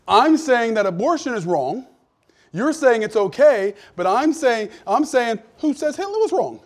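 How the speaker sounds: preacher retelling how he corners an atheist; forceful argument. Retold or imitated. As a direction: drive each point home slowly. Heated debate with atheist.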